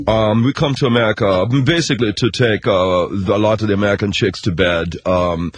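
put-on lazy German-accented pop star boasting. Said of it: "Like Milli Vanilli?".

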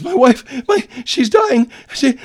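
high, loud cracking fake distress. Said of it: "this very high crack".

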